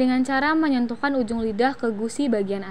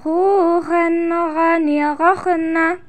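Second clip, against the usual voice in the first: very slow, higher chant; drawn-out recitation drill; swung, held syllables; 5 semitones higher, much slower than usual. Shown as a demonstration. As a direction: stretch vowels over snore-like kh sounds. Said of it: "ayu ayun mana tahan".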